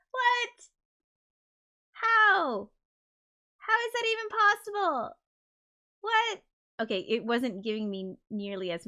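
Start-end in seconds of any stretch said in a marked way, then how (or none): 0.1-6.4 high squeaky what and how; genuinely floored by the number; stunned disbelief. Real, not acted. Shocked at absurd CPU percent.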